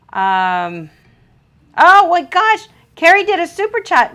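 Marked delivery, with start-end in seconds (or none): 1.8-4.1 high, loud gasp of delight; delighted surprise. Real, not acted. Host thrilled reading a viewer's super chat, delighted surprise. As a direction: squeal the news out, thrilled.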